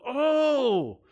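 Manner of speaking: put-on high, loud, frustrated "oh" at a child; exasperated groan. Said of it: "You look at your child and you go".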